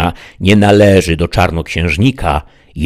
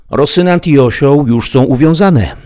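a somewhat higher, puzzled servant voice; Sancho, the simple squire; 9 semitones higher, 6 dB louder than usual. Acted. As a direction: play the baffled squire asking his master. Sancho reports to master.

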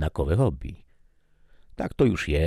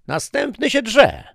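much higher, louder wife's voice, wry; Maria's quip; 9 dB louder than usual. Acted. Wife commenting on husband's scream, high sharp quip. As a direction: toss off a dry remark at him. Maria quips over his yell.

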